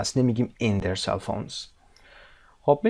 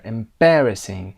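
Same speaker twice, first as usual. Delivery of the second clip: EMBARRASSING drawn out with BAR louder; stress on BAR; 4 dB louder, much slower than usual. Shown as a demonstration. Teacher confirms the stress, BAR louder in EMBARRASSING. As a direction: hit BAR in EMBARRASSING, slowly. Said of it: "استرس روی بر".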